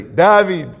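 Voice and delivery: David said the Israeli way, loud and falling; Israeli pronunciation. Shown as a demonstration. Storyteller correcting himself on the boy's name, Israeli pronunciation of David. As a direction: hit David firmly, as an Israeli would. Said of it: "Sorry, if he's Israeli".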